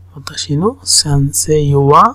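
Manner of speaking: normal talking voice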